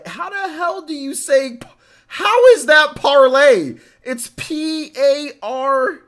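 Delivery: loud, high-pitched incredulous outburst; exasperated disbelief. Real, not acted. Streamer baffled by a viewer's username, exasperated disbelief. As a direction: demand an answer in baffled disbelief.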